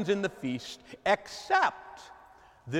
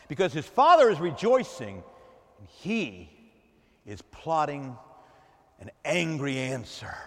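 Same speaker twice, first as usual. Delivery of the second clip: normal talking voice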